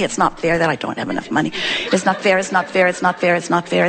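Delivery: low, monotonous repeated grumble; droning complaint. Acted. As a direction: chant it on and on like a stuck record. Acts out beating the negative drum.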